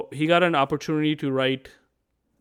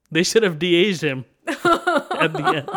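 real laugh breaking into the words; 4 semitones higher, 3 dB louder, slower than usual. Real, not acted. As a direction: joke it, then laugh through the end. Joking about the aged singer's look.

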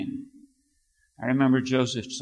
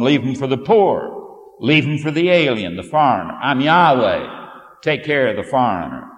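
loud, solemn preaching read of the law; scripture reading; 10 dB louder than usual. Acted. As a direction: proclaim each command to the congregation. Preacher reads Leviticus aloud.

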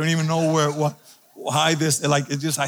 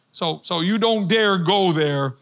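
ordinary talk

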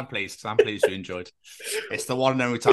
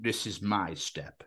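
lightly voiced snooty cat, quiet and slow; haughty cat voice; 6 semitones lower, 5 dB quieter, slower than usual. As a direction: play a disdainful cat, softly. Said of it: "cats would be like".

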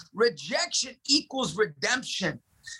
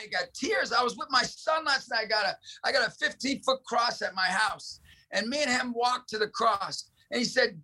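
ordinary talk, nothing marked

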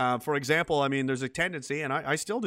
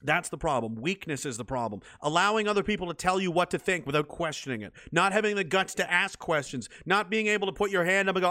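ordinary delivery

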